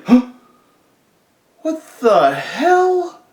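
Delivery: very high, loud, slow confused cry; jolted awake and baffled; startled outrage. Retold or imitated. Stoned cellmate startled awake, high and loud.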